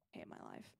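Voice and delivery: whispered grumble. Real, not acted. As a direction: mutter it under your breath. Muttered complaint at bad loot.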